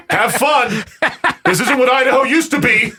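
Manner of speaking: high, hammed-up online heckler; jeering high-pitched mock shout; mock yelling. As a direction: yell it like an outraged commenter. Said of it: "just start yelling at 'em".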